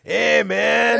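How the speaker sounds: put-on high, loud Mexican-accent caricature; mock Mexican accent. Host as hotline operator, hammed-up Mexican accent. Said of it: "English with a Mexican accent".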